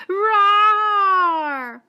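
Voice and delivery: loud, high, frightened lion roar; fearful roar. Acted. Trapped lion roaring in fear, loud and high. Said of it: "He roared with fear".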